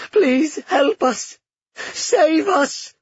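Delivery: high, loud, drawn-out pleading; desperate cries. A narrator's reading. Trapped people beg the princess from the pit, desperate. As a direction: wail for rescue. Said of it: "the mournful sound of cries".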